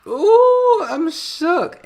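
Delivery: a fan squealing at the father's comeback; shook squeal. Real, not acted. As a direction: squeal like the drama just hit you. Reacting to dad's retort.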